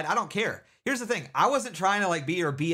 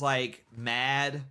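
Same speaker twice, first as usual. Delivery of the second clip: normal talking voice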